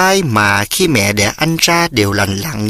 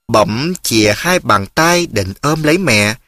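plain reading voice

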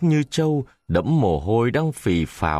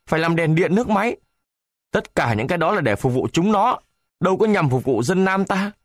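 nearly shouting; 8 semitones higher than usual. A narrator's reading. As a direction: hammer every point home furiously. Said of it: "nói như quát".

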